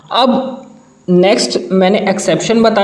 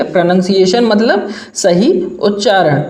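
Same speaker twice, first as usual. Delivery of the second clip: normal talking voice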